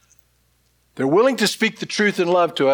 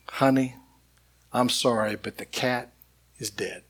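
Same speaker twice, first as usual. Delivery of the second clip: much softer and lower, gentle and sorry; tenderly; 4 semitones lower, 6 dB quieter than usual. Retold or imitated. Wife showing her husband how to break bad news, tender and soft. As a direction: break it gently, fading almost to nothing. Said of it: "you should tenderly take me aside".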